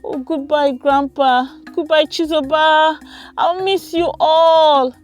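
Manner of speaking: high, drawn-out farewell; reluctant goodbye. A narrator's reading. Girl leaving grandparents and cousin, high reluctant farewell. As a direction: call goodbye high, stretching the last words. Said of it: "Saying goodbye was hard".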